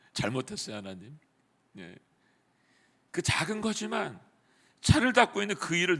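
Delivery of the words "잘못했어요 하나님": soft, contrite whisper-like apology; humbled apology. Retold or imitated. Staff member falling before God, soft contrite apology. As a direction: say it quietly, broken and repentant. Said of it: "하나님 앞에 납작 엎드린 거죠".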